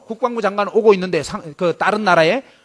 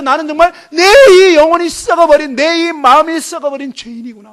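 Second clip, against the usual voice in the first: louder, higher cry of repentance; fervent confession; 4 semitones higher, 7 dB louder than usual. Retold or imitated. Preacher voices students confessing as sinners, louder and higher. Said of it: "하나님 앞에서".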